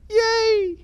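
high squealed mock yay; sarcastic cheer. Coach mocking the schedule with a high sarcastic cheer.

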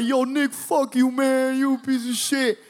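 high, tearful mock outrage; upset cursing. Crying kid leaving bathroom to curse Nick, high mock outrage. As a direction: curse him out in a wounded high wail.

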